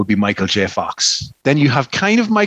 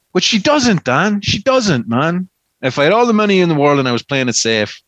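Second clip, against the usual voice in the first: usual speaking voice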